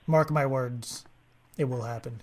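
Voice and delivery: ordinary delivery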